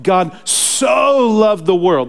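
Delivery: "so" drawn out long, high and loud; stress on SO. Real, not acted. Preacher quotes Jesus to the church, "so" hugely stretched. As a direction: lean hard and long on "so".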